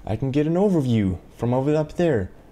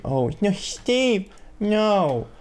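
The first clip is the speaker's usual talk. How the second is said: high, slow, drawn-out oh no; alarmed plea; 10 semitones higher, much slower than usual. Real, not acted. Gamer begging the character Steve not to fall, high and slow. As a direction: plead with Steve as he slips.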